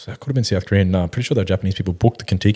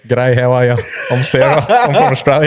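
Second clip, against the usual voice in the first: higher, broad Australian g'day, rising louder; Aussie girl impression; 4 semitones higher, 4 dB louder than usual. Mate retells Adelaide girl's reply, mock broad Aussie. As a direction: mimic a chirpy Aussie girl correcting him. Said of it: "And she's like".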